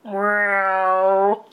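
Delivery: long, drawn-out mock meow; imitated cat meow. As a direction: meow slowly like a trapped cat. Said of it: "but then Hubert hears".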